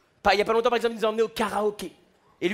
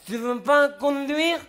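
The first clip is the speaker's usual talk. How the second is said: put-on drunk dad; 6 semitones higher, 4 dB louder, much slower than usual. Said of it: "il m'a dit".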